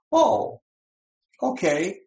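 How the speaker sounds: lightly voiced obedient brain, high on the "oh"; high-pitched, agreeable little reply; compliant brain. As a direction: go up high as if instantly convinced.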